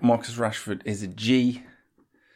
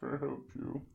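butler answering door; 8 semitones higher than usual. Acted. Skit, answering the door in role.

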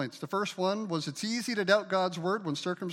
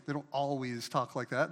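normal talking voice

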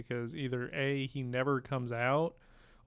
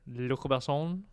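French accent; 3 dB louder, slower than usual. Shown as a demonstration. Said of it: "really terrible French accent".

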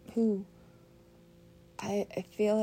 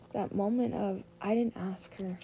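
ordinary talk, nothing marked

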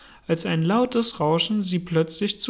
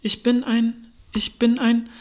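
higher-pitched, unfinished attempts; faltering protest; 5 semitones higher, 3 dB louder than usual. A narrator's reading. Alice gropes to say what she is, faltering. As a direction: start insistently, then break off searching.

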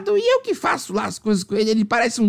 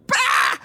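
very high, sharp tearing noise; imitated rip. Waxer tearing off the strip, imitated rip. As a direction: rip it out in one high burst. Rip ends her life story.

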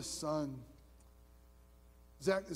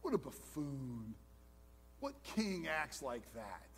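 scornful wife; 7 semitones lower, 7 dB quieter than usual. Retold or imitated. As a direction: belittle David like a disdainful queen. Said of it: "a wife ... saying".

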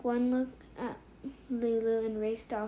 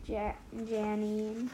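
ordinary delivery, nothing marked

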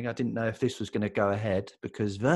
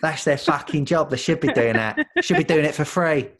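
mock Daily Mail; 5 semitones higher, 5 dB louder than usual. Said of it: "here she is, the Daily Mail".

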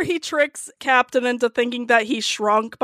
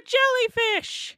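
slow, much higher mock cry of Jellyfish; Bluey episode title; 12 semitones higher, 4 dB quieter, slower than usual. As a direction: call out Jellyfish like a startled cartoon kid. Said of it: "This episode of Bluey is called Ah".

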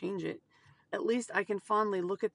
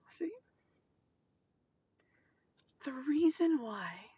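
emotional whisper; 4 semitones higher than usual. Real, not acted. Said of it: "I am emotional now".

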